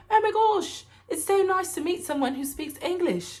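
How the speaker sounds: very high-pitched mock English accent. Delighted stranger greeting a fellow English speaker in Paris, mock English accent. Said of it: "she has the accent from the U K".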